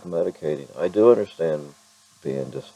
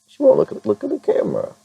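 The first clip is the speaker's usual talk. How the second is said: much higher squeaky coax; faster than usual. Real, not acted. Owner coaxing her dog to look at the camera in a high voice.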